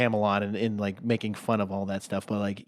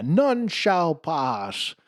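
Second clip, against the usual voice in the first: very slow, higher, drawn-out knight voice; 8 semitones higher, 4 dB louder, much slower than usual. Retold or imitated. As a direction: intone it slowly like an immovable knight.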